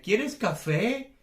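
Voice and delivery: "quieres café" slow, loud, swooping up; exaggerated question intonation. Shown as a demonstration. Teacher models a Spanish question, "quieres café" with exaggerated rise. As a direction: stretch it and send "café" high. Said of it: "No, exagero".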